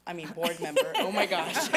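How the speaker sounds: flustered laugh, high and loud; nervous laughter. Real, not acted. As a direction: laugh it off, embarrassed. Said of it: "I'm sorry, I'm nervous".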